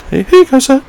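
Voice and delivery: tiny high squeaky deferential voice; hammed-up falsetto servility; mock meek politeness. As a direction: squeak it out like a timid, obedient passenger. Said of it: "I didn't say it like that".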